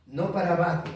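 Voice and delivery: usual speaking voice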